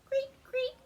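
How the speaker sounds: very high cricket creaks; cricket chirps. A narrator's reading. Crickets in the grass, very high creaks. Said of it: "The crickets go".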